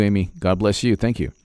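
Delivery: ordinary delivery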